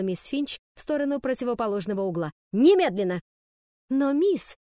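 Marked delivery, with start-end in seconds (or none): 2.5-3.2 loud, sharp teacher's order; barked command. A narrator's reading. Angry teacher orders pupils to corners. 3.9-4.6 high, loud pupil's objection; protesting. A narrator's reading. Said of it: "в один голос запротестовали".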